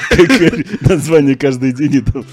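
talking through laughter, high; giggling out the words; laughing. Real, not acted. Laughing while telling about room names. (со смехом)

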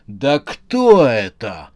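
slow loud yell into the phone. Acted. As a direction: yell it, drawn out. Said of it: "заорал он в трубку".

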